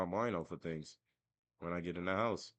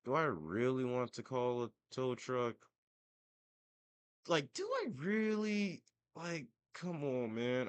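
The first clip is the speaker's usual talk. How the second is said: higher-pitched, dragged-out mock pleading; retold scared reluctance; 6 semitones higher than usual. Son dreads paying for a tow truck, scared reluctance. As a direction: whine it reluctantly, stretching the words. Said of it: "I got scared 'cause I'm like".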